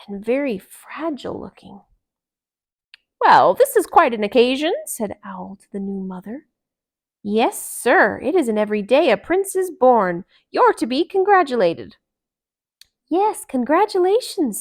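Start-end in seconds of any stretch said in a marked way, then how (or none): 3.2-12.0 owl congratulating. Acted. Owl addressing new mother. 13.1-14.6 high, loud congratulations; all wish her well at once; cheerful chorus. Acted. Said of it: "said everyone".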